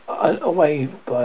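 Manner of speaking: ordinary delivery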